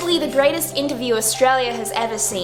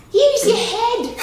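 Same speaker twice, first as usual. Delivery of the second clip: high, slow, louder quip; high comic line; 9 semitones higher, 4 dB louder, much slower than usual. Acted. Telling Barry to use his head, high slow quip. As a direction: drag out the pun.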